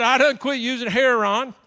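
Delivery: "Heron" for heroin, said loudly; loud dialect "Heron"; dialect pronunciation. Retold or imitated. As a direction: imitate her emphatic "Heron", like HAIR-on.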